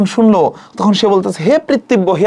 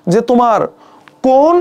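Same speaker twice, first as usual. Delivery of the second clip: normal talking voice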